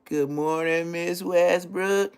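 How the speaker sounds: slow singsong, WESTBROOK stretched upward; drawn-out greeting. Retold or imitated. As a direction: imitate a pupil chanting a greeting. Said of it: "walk in that class and say".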